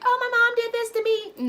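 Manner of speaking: high, whiny mocking voice; mock a self-pitying victim; whiny mock complaint.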